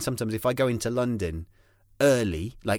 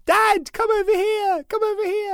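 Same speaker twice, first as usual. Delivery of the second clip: imitating daughter calling; 4 dB louder than usual. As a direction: whine for dad to come over. Daughter calling dad over.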